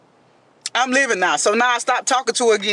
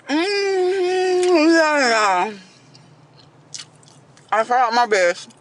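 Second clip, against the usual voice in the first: mock crying; 2 semitones lower, slower than usual. Confessing to the audience what happened: mock tearful. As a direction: fake sobbing confession, voice breaking.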